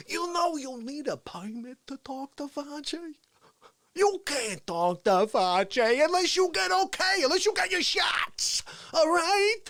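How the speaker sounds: puffed-up, scolding showman; hammed-up political impression. Cutting off a reporter asking for Fauci, hammed-up indignation. Playing Trump with Fauci as press guard.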